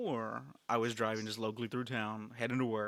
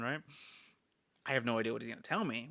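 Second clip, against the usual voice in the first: ordinary delivery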